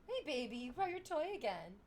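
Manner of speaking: high, cooing talk to a pet. Real, not acted. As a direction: coo sweetly to a beloved pet. Greets pet bringing a toy.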